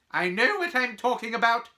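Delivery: high, loud, curt cut-off. Acted. Professor cutting off a doubting pupil, curt. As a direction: shut the student down sharply. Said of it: "she said shortly".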